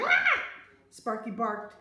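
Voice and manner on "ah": sudden very high, loud shriek; startled scream. A narrator's reading. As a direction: scream as if grabbed by surprise. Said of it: "and I screamed".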